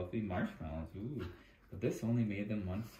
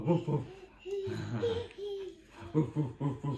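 playful woof woof like a puppy; mock barking dog; 4 dB louder than usual. Parent barking like a puppy for the giggling child. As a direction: bark like a dog for the toddler.

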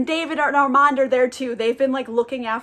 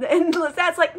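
ordinary delivery

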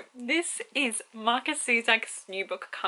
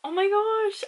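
excited gasp; 12 semitones higher, 4 dB louder, slower than usual. Real, not acted. Excited at book discovery.

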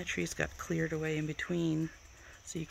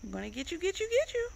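high, rising sing-song threat; playful pet teasing; 13 semitones higher, faster than usual. Real, not acted. Owner teasing the bird, high playful pet talk. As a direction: tease it in a squeaky pet-talk voice.

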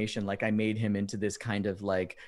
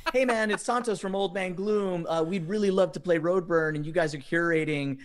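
mock eager request, voice lifted; politely asking a favor, higher pitched; friendly pitch; 6 semitones higher than usual. Bandmate writing Thomas to ask for a festival slot, mock eager.

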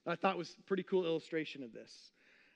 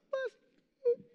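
tiny, very high-pitched echo; comic falsetto afterthought; mock blessed squeal. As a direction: squeak it out in mockery. Mocking wish to get blessed.

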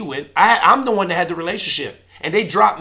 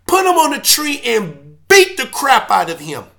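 raised, loud, pleading rant; impassioned outburst; 9 semitones higher, 6 dB louder than usual. Real, not acted. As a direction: pour out a wounded anger, pitched high. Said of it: "I got emotional".

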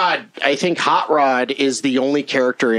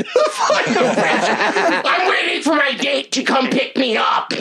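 laughing; 11 semitones higher, much slower than usual. Real, not acted. Laughing while retelling embarrassment.

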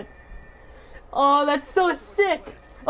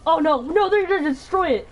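normal talking voice

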